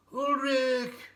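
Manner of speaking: a high, loud call from far off; distant cry. A narrator's reading. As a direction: call his name across the mountain. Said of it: "a cry".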